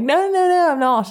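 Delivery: high-pitched mock English denial; English accent. Catfish denying he's English to his date, high mock English accent. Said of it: "You're blatantly talking in an English accent".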